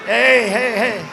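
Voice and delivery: hammed-up angry calling out; agitated rival. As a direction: imitate an agitated man telling off hecklers. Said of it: "he's very agitated".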